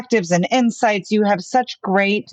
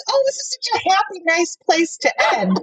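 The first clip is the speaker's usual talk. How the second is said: cheery; 10 semitones higher than usual. Retold or imitated. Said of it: "I was about to be like".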